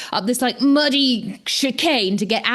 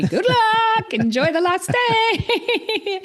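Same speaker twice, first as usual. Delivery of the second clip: mock cheery send-off; 12 semitones higher, much slower than usual. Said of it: "And I was like".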